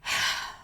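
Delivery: short audible sigh, shown as a demo; demonstrated sigh. Shown as a demonstration. Showing how the woman sighs at work, audible sigh. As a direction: let out one heavy demo sigh. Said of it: "she says that I'll do this".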